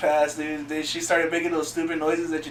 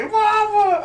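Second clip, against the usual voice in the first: squeaky, much higher, louder mama; high-pitched mama; 5 dB louder than usual. Retold or imitated. Dad calling to the fainting mom, high squeal. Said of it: "Dad's like".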